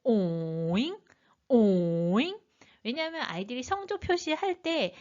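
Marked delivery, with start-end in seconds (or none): 0.0-1.0 오잉 slow, dipping low then swinging up; third tone. Shown as a demonstration. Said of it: "내려갔다 올라가면서". 1.5-2.4 scoop 오잉 down and back up, drawn out; third tone. Shown as a demonstration. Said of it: "내려갔다 올라가면서".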